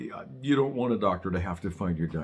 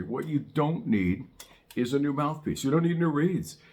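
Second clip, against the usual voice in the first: ordinary talk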